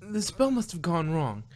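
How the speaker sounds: plain reading voice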